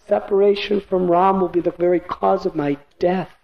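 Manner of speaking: helpless father pleading for his son; desperate begging. A narrator's reading. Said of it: "he begged her".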